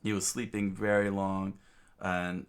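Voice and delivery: plain reading voice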